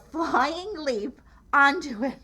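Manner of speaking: ordinary delivery, nothing marked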